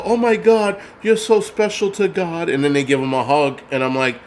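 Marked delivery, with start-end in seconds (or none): 0.0-2.5 high, syrupy mock praise, hammed-up; mock gushing. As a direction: coo it like a fake fawning fan. Mocking gushing Democrats.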